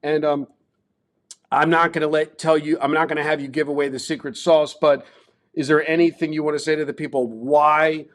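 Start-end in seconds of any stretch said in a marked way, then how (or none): none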